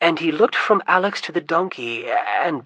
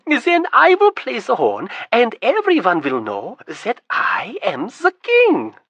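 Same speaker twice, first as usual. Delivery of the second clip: much higher, louder, gloating glee; gleeful scheming; 8 semitones higher, 8 dB louder than usual. Acted. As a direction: crow over the plan to humiliate Tryon. Prince gleefully plotting his triumph.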